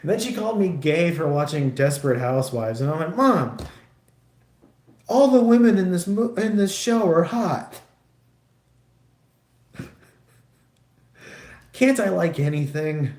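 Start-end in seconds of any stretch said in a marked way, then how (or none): 3.2-13.1 higher, much louder protest; exasperated mock yell. Son protesting to mom about Desperate Housewives, loud and exasperated. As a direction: protest at full volume, fed up.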